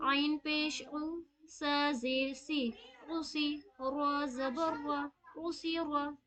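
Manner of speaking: a Quran teacher drilling pupils, slowly parsing each letter; slow syllable drill. Shown as a demonstration. Teacher slowly drilling उसी रा letter by letter. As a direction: spell out each letter and vowel mark slowly, then the word. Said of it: "देखिए ध्यान से सुनिएगा".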